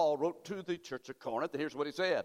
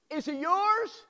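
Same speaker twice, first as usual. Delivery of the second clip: pushed up high and urgent; higher, pressing question; 10 semitones higher, 4 dB louder, slower than usual. Shown as a demonstration. Preacher repeating question.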